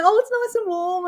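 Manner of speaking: high cooing pet voice; baby talk. Retold or imitated. Taking the chicken's warm egg, cooing in baby talk. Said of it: "make those baby noises that you do".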